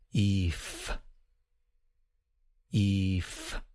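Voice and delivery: "Eef" with long ee into voiceless f; sound-building drill. Shown as a demonstration. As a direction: model "Eef" evenly, leaving room to repeat. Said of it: "Repeat after me".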